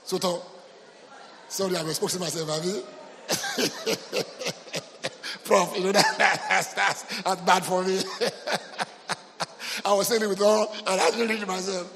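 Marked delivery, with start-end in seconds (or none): 2.9-5.4 helpless, wheezy run of giggles; real laughter. Real, not acted. Pastor laughing at himself before congregation, helpless giggles. 5.4-11.9 real laughter breaking up the words; laughing. Real, not acted. Pastor confessing his own mistake to congregation, laughing as he talks.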